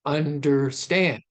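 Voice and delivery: "Understand" slowly in three beats, landing hard on "stand"; overemphasized anapest stress. Shown as a demonstration. Lecturer overemphasizes for clarity, slow stressed last syllable on "Understand". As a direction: stretch "Understand" out, two lead-in syllables then a stressed "stand". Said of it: "I'm overemphasizing here for clarity".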